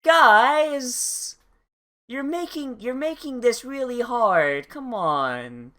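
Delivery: mock exasperated DM. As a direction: whine at the players like a weary game master. Playing the exasperated DM.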